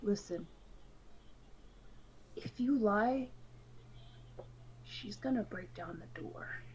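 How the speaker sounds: leaning in, dropping to a whisper; a low, confidential warning with pauses; hushed warning. Acted. Ivan leans in.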